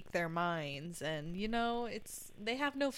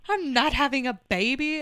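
mock exasperated denial; 6 semitones higher, 8 dB louder, faster than usual. Denying kids' baby questions.

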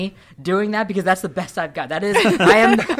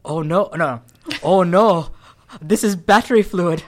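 loud, higher-pitched mock Indian accent; hammed-up Indian accent, meant as British; 5 semitones higher, 6 dB louder than usual. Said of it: "it's gonna sound Indian".

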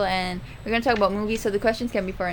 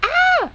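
short, high loud squeal; excited high yelp; sudden squeal; 6 dB louder than usual. Real, not acted. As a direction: let out a piercing little "Ah!". Startled cry before presenting.

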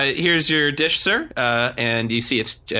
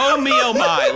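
flustered waiter; 9 semitones higher, 4 dB louder than usual. Pretend. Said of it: "I want you to be the waiter".

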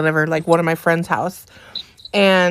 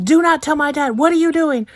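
panicked plea; 8 semitones higher than usual. Retold or imitated. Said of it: "chasing after him, like".

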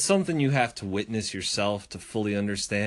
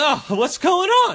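imitating neighbor; 6 dB louder than usual. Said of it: "And he's like".

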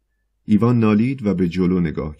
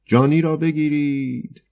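much higher, slow, drawn-out cry of جانی را بگیرید; demo shout; 8 semitones higher, slower than usual. Shown as a demonstration. Choirmaster shows the poet how to cry جانی را بگیرید, high and slow. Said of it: "اول کاری که باید بکنی این است که داد بزنی".

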